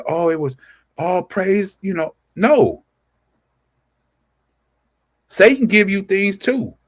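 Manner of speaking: ordinary talk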